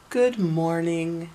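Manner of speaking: lightly voiced, slow cheerful good morning; a mild hint of a cheery Mister Happy, drawn out; cheerful greeting. Mister Happy greets Miss Chatterbox at the bank, slow and cheerful. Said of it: "he said cheerfully".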